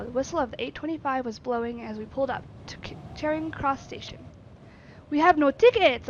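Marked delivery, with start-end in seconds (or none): 5.1-6.1 loud alarmed exclamation; loud panicked valet cry; exclaiming. Acted. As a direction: blurt it out in alarm. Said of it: "I exclaimed".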